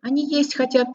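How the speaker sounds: high squeaky little voice. Acted. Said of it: "пропищала зайчиха". (писклявым голосом)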